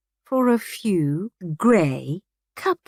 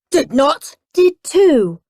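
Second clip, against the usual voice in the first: bickering; 7 semitones higher, 8 dB louder, slower than usual. Acted. Siblings bickering.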